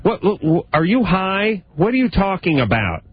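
high-pitched, incredulous confrontation; acting indignant wife. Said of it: "why did you say to your husband".

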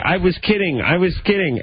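high-pitched mock pleading; mock yelling. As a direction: imitate a panicked husband protesting. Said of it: "reports of a guy yelling".

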